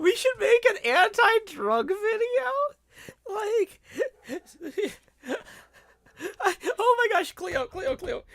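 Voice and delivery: high, loud, wheezing laughter; laughing. Real, not acted. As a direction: barely get words out through laughing. Helpless laughter at own joke.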